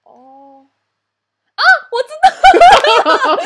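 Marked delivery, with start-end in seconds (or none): 1.5-3.5 shrieking with laughter, slow; loud laughing outburst. Real, not acted. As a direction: burst out laughing loudly. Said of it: "叫太大声".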